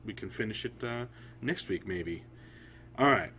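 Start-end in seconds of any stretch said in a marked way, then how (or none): none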